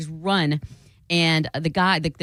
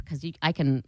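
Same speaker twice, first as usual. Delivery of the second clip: ordinary delivery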